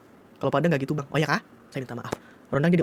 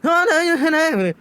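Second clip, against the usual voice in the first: mock Padang babble; 12 semitones higher, 4 dB louder than usual. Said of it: "Nggak tahu apa dia bilangnya".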